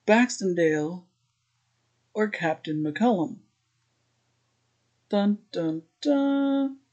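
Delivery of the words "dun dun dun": dramatic sting. Pretend.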